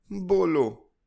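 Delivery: put-on high little girl, as if doing a favour; a child granting permission, high; grudging child. A narrator's reading. Chilki grudgingly tells the storyteller to go on, high child voice. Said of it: "उसने जैसे मुझ पर एहसान किया".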